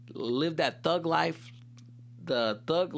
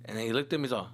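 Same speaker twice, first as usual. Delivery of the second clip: ordinary talk, nothing marked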